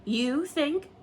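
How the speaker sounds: scornful, high-pitched "You think?"; utmost contempt. A narrator's reading. Spinster mocking her sister, full of contempt. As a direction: sneer it back at her. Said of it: "voice expressed the utmost contempt".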